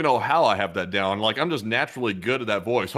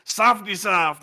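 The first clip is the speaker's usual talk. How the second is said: mock old man voice; 8 semitones higher than usual. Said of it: "we do the voices".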